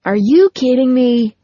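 half-shouted disbelief; mild yell. A narrator's reading. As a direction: yell at the screen, held back a little. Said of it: "R J yelled at the screen".

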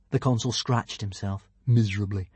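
ordinary delivery, nothing marked

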